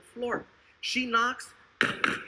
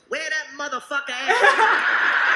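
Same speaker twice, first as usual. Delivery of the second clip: eager loud demand; 3 semitones higher, 8 dB louder, slower than usual. Acted. Woman agreeing for fifty bucks.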